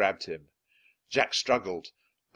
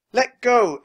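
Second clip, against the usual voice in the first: high, loud demand; very high-pitched angry shout; shouting in his face; 11 dB louder than usual. A narrator's reading. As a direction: yell defiantly at a captor. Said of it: "Annie shouted into his face".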